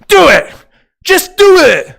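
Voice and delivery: high, loud motivational shout; Shia LaBeouf. Acted. Streamer doing the do it that chat wants, shouted. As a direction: yell it as a hammed-up impression, punching DO. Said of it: "you want the Shia LaBeouf do it".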